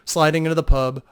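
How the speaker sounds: plain reading voice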